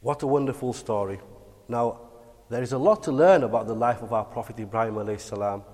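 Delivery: ordinary delivery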